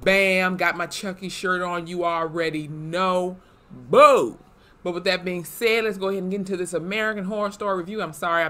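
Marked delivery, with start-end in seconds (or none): 3.7-4.4 sudden loud boo to spook the viewers; playful boo. Pretend. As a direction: jump out and scare them. Spooky jump-scare at viewers.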